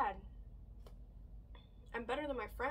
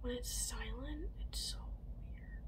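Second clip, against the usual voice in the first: whispering; 15 dB quieter than usual. Real, not acted. Talking about the silence.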